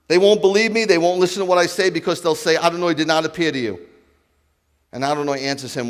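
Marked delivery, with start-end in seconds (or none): 0.1-3.9 louder, higher, pleading that they won't believe; anxious protest. Acted. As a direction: push back with rising worry. Moses protests to God.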